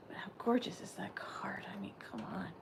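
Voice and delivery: hushed, breathy aside; awed whisper over a card. Real, not acted. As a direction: whisper it in awe to yourself.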